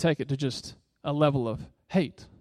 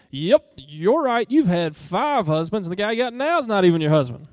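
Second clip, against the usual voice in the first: arrogant sarcasm; 4 semitones higher, 5 dB louder than usual. Retold or imitated. Preacher mocks a gloating Jesus to the congregation, loud and sarcastic. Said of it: "So with this arrogance, with this boastfulness".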